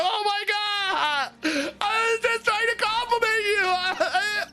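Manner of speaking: high, teary hammed-up whimper; mock crying. Answering Fred after he snapped at her, mock crying. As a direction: whine it like you're about to cry.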